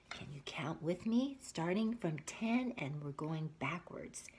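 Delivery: whispered invitation; hushed, inviting whisper. Real, not acted. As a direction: lean in and whisper it. Invites child to count.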